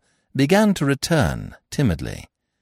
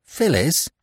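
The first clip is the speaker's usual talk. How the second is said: gasped; 7 semitones higher than usual. A narrator's reading. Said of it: "he gasped".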